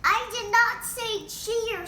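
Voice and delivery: high loud shout. Acted. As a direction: shout it over the engine. Frank shouts over the bike.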